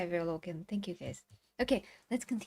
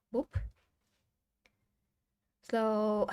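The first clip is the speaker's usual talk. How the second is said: ordinary delivery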